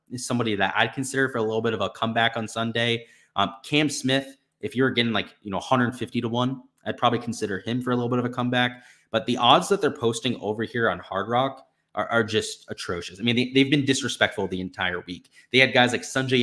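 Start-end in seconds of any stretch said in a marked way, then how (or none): none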